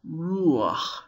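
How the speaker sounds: "Ruach" gliding from u into a short a before ch; gliding vowel. Shown as a demonstration. Teacher shows the vowel pronounced before the chet, gliding vowel. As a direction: let the a slip in before the final ch of "Ruach". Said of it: "It's kind of a glide".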